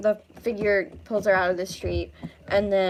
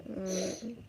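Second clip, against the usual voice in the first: ordinary talk, nothing marked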